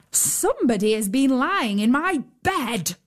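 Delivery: big bear grumbling, rough and gruff; hint of a gruff papa bear; great rough gruff voice. Papa bear finds his bed slept in, gruffly. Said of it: "in his great rough, gruff voice".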